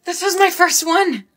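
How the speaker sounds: high-pitched excited news. Acted. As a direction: say it thrilled, pitch lifted high. First soulmate letter arrives.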